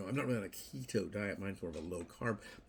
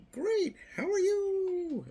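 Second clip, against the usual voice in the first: much higher, louder, stretched very slow; mock cheery greeting; 9 dB louder, much slower than usual. Acting out a hollow greeting, shrill and drawn out. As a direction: falsetto fake cheer.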